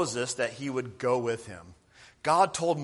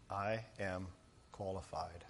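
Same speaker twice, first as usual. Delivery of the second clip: much softer and lower, almost breathed; tender; 5 semitones lower, 15 dB quieter than usual. Acted. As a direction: speak it as God's gentle reassurance. God's gentle verdict on the listener.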